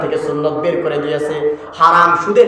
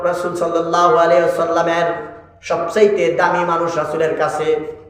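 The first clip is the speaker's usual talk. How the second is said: ordinary talk, nothing marked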